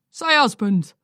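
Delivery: husband scolding his wife over a word; grumpy old man. A narrator's reading. Angry old man ranting.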